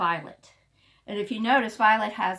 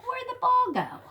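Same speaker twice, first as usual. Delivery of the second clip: much higher, baffled question; puzzled dog; 3 dB louder than usual. Acted. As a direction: play the confused dog searching for her ball. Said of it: "She's like".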